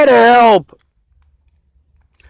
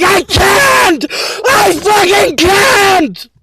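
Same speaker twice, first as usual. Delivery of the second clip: high, cracking yell of I can't; desperate shout; 10 semitones higher, much slower than usual. Retold or imitated. Shouting back at Miss Peaches' demands, near tears. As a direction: cry out that you can't take it. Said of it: "I'm like".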